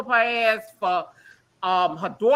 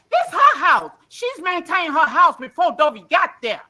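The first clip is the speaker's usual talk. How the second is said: indignant insistence; 5 semitones higher, 5 dB louder than usual. Real, not acted. Defending the lady, heated.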